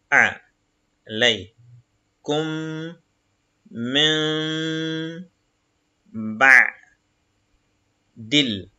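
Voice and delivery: each Arabic syllable said apart, slowly; syllable reading. Shown as a demonstration. Teacher reading Arabic syllables to the class, slow and separated. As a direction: spell the word out syllable by syllable.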